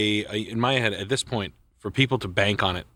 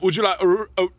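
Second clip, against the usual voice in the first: imitated cashier; 7 semitones higher, 4 dB louder than usual. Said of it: "And he's like".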